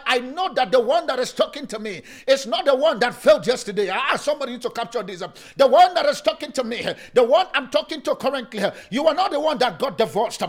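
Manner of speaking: loud, rising preacher's call; fired-up pulpit preaching. Acted. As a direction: drive it home to the congregation. Preaching at full force.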